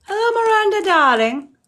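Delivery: a playful over-the-top darling; mock grand greeting. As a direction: gush over the name like a stage diva. Theatrical greeting to a viewer.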